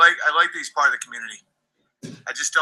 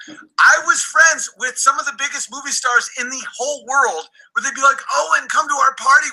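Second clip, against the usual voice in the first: higher, louder furious rant; angry rant; 6 semitones higher, 3 dB louder than usual. Real, not acted. Streamer raging at a chatter he threatens to ban, angry rant. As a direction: tear into him, voice up.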